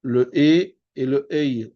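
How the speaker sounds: E then Ei, slowly and distinctly; contrasted vowels. Shown as a demonstration. Teacher showing students the vowel difference, contrasted vowels. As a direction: set a pure E against a gliding Ei. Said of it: "La différence entre".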